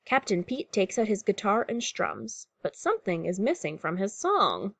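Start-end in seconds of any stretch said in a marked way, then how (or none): none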